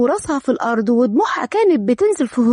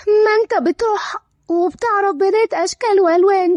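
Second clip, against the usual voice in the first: higher-pitched, tearful protest; 5 semitones higher than usual. Acted. Lora protests to Karem nobody cares, tearful. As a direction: complain through tears of being neglected. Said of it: "لورا بدموع".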